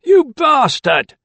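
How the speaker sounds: drawn-out, loud furious curse; slow angry shout. A narrator's reading. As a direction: hurl it after him slowly. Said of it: "he cried".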